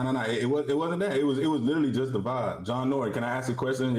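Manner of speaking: normal talking voice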